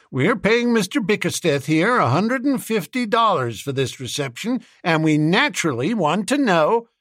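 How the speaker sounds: high-pitched, reasonable businessman; businessman chipping in. A narrator's reading. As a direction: back up a colleague in a high voice.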